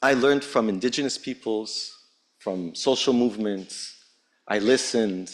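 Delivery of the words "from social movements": ordinary talk, nothing marked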